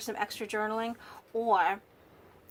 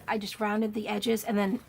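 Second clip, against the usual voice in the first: ordinary talk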